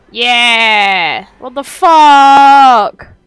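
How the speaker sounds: loud, long drawn-out holler; excited yelling. Real, not acted. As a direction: bellow it and stretch the last word. Yelling at the game's action.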